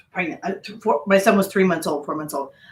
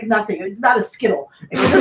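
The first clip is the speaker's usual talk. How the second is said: ordinary delivery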